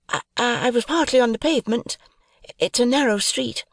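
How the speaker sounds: put-on high, timid young woman; sweet, shy plea for help.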